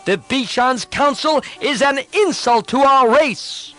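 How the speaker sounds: proud son bristling with contempt; hot-headed prince bursting out in scorn; impatient outburst. Acted. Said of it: "lost patience and burst out".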